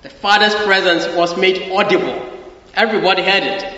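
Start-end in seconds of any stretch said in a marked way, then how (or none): none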